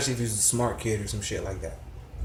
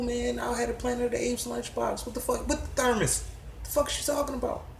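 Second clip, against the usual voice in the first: incredulous; 11 semitones higher than usual. Real, not acted. Incredulous rant.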